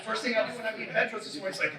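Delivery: ordinary delivery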